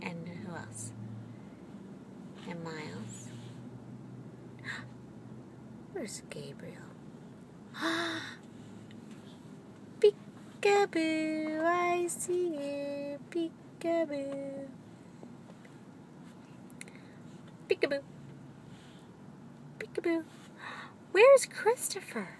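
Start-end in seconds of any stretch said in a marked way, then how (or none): 3.3-5.9 mock gasp. Mock gasp playing peekaboo. 6.8-10.6 playful gasp. Pretend. Hiding gasp before peekaboo. 10.6-22.3 put-on high, slow singsong to a baby; drawn-out high peekaboo voice; playful peekaboo. Playing peekaboo with toddler.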